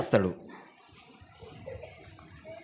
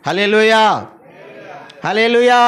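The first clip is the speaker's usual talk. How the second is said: called-out hallelujah. Real, not acted. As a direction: call it out to the whole church. Preacher calls out to congregation.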